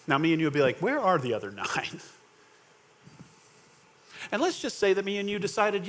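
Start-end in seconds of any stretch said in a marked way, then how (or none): 0.8-2.0 mock indignation. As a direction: echo it as a pointed question. Imagines them echoing Jesus' question.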